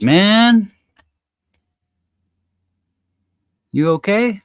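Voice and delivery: high, loud bartender voice. Acted.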